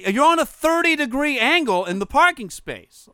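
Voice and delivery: high, loud and drawn out; exasperated scolding. Retold or imitated. Driver spelling out the bad parking to her, exasperated. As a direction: hammer it out slowly, high and loud.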